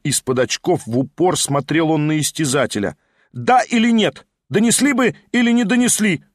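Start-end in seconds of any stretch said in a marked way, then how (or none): 3.3-6.2 higher, demanding shout; insistent shouted question. A narrator's reading. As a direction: press him hard for an answer. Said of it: "крикнул Верховенский".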